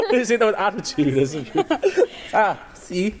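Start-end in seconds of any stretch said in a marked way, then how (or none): 1.7-2.3 high giggle burst; high laugh. Real, not acted. Laughing at joke.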